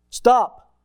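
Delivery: loud, high stern command; sharp stop. Shown as a demonstration. Parent stopping a child at the road, loud command. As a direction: stop them dead with one word. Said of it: "set a boundary with your voice".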